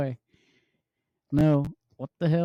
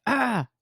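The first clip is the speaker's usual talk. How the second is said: mock mummy scream; 7 semitones higher than usual. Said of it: "The mummy".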